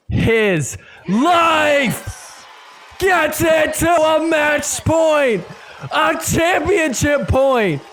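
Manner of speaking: rising play-by-play roar; excited shout. Real, not acted. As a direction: call it like the whole arena is watching. Match point call.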